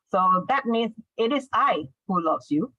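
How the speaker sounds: normal talking voice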